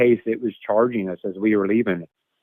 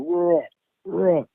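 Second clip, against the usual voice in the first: higher, hammed-up roar; mock lion roar; 7 semitones higher than usual. Retelling the zoo lions, a mild mock roar. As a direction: imitate a lion roar lightly. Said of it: "that roar they do".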